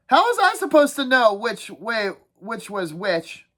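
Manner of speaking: put-on defensive kid dropping off at the end; high voice that fades out slowly; Piggy's voice. Piggy pleading his excuse.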